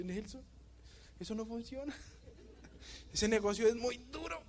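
high, whiny crying complaint; mock whining. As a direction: whimper like a crybaby to Nelson. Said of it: "Me voy llorando pa' donde Nelson". (quejándose a Nelson, agudo y llorón)

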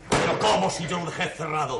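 indignant outburst. Acted. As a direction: accuse them of lying. Master suspicious about gate.

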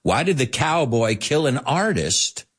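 ordinary talk

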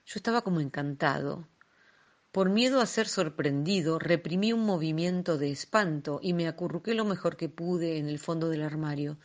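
plain reading voice

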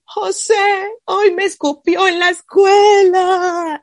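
put-on child sobbing about school; imitated crying. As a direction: cry it out like a hurt child. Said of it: "me abrazaba llorando me dijo".

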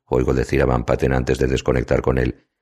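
usual speaking voice

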